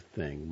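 "thing" ending in a little breathy guh; thing with a puff. Shown as a demonstration. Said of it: "this extra puff of breath".

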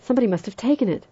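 accused doctor protests hotly; violent protest. A narrator's reading. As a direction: blurt it out fiercely. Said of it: "Armstrong said violently".

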